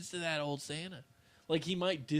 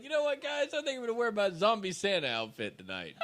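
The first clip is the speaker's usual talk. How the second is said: high, eager mock voice of a friend; mock hyped friend; 6 semitones higher than usual. Mocking a friend to his co-host, high and eager. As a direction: imitate an excited buddy announcing his plan.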